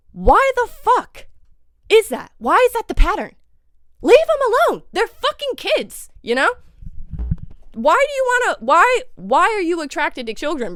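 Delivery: high, loud exasperated demand; real pissed-off outburst. Real, not acted. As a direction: let real outrage push the voice high. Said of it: "I'm pissed".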